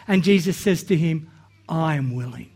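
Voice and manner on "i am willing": low, gentle, much softer "I am willing"; hushed, tender "I am willing"; softly. Retold or imitated. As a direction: say "I am willing" quietly and tenderly, as Jesus to the leper. Jesus answering the leper.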